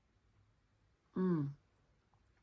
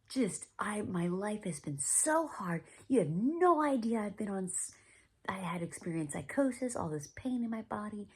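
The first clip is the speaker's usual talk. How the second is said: mildly heated retold protest, pitch lifted; angry protest; 6 semitones higher, 5 dB louder than usual. Patient protesting to mentor about a hard year, angry. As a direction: plead your case indignantly, reliving it. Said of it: "I was angry".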